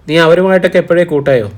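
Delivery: a suspicious mother pressing her daughter; sharp question. A narrator's reading. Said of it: "തെല്ല് മൂർച്ചയുണ്ടായിരുന്നു". (മൂർച്ചയുള്ള ചോദ്യം)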